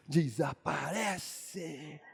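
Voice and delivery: slow, low, hushed magic word; magician's incantation. Pretend. Magician making ketchup vanish, slow low incantation. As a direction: draw the word out softly like a stage magician.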